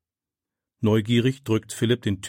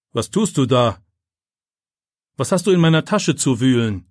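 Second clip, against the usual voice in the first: teacher's sharp challenge, rising louder; stern accusation; 2 semitones higher, 5 dB louder than usual. A narrator's reading. Teacher catches pupil rummaging in his bag, stern and accusing. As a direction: confront someone caught at your bag.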